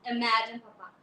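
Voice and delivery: plain reading voice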